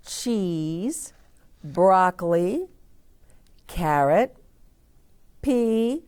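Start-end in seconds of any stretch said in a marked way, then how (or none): none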